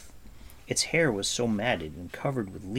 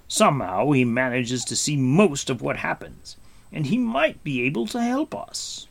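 raised, commanding prince voice; Gwydion's voice; 6 semitones higher, 6 dB louder than usual. A narrator's reading. Gwydion explaining the creature to Taran, in a raised lordly voice. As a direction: speak with lordly assurance.

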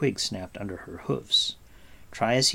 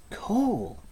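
much higher, incredulous question; astonished cry; 12 semitones higher than usual. A narrator's reading. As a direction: echo the name in disbelief. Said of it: "Taran cried".

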